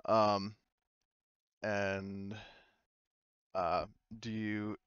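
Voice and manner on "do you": ordinary delivery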